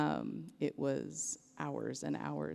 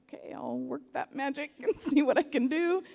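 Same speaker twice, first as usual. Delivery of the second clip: laughing; 11 semitones higher, 10 dB louder than usual. Retold or imitated. Retold own reply, laughing.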